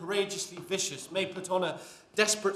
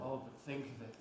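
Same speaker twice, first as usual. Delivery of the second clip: hushed; 4 semitones lower, 12 dB quieter, much faster than usual. Acted. Hushed doubt about the plot.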